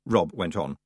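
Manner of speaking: ordinary delivery, nothing marked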